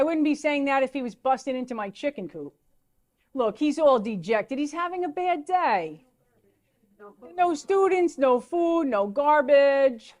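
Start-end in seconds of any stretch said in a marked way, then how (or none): 4.6-10.1 mock pity for the bear. Pitying the dejected bear.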